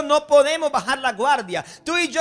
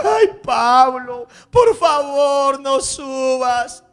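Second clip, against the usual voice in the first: slow, loud wailing plea; crying plea; 6 dB louder, much slower than usual. Acted. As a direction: wail it out, begging through tears. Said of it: "empiezan a llorar".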